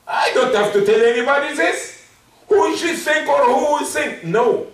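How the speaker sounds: high-pitched, defiant protest; indignant refusal. Acted. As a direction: protest as if above explaining yourself. Acts out the proud refusal.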